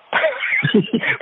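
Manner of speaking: sudden high burst of laughter. Real, not acted. Man laughing mid-story to friend, high burst of laughter. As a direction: laugh out at own joke. Laughs at phone cover joke.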